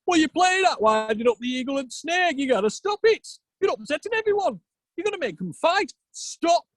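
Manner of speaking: high, exasperated telling-off; scolding Odin. Acted. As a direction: scold like a fed-up god. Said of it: "Odin said".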